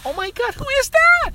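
very high gushing mom voice; mock excited squeal. Friend's mom at the door, mock high squeal.

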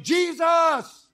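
high shouted answer; crowd yelling back. Retold or imitated. Congregation yelling back the name, high shout. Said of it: "everybody yells out".

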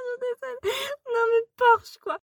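high squealing outrage. Real, not acted. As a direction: shriek the words, laughing. Laughing outrage at character.

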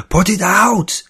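very high, loud screech. A narrator's reading. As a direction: shriek the order. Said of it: "he heard Vargas screech".

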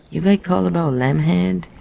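lightly voiced Middle Eastern butcher; Middle Eastern accent. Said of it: "he asked in a Middle Eastern accent".

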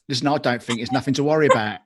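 loud, fast mock-Cockney accent; Danny Dyer Cockney impression. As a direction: put on a brash Cockney, rattled off loudly. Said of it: "you'd imagine it's Danny Dyer who says".

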